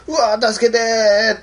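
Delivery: high, drawn-out wail for help; mock-panicked plea, stretched out long; acted cry for help. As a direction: play a slow, high-pitched yelp for rescue.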